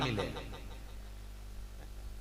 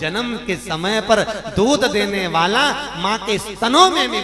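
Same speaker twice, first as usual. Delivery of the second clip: slow, swelling louder Gita proclamation; preaching at full force; 4 semitones higher, 9 dB louder than usual. Acted. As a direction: declaim the divine words, building in force. Quotes the Gita in sermon. (धीमी, बढ़ती हुई ज़ोरदार प्रवचन-वाणी)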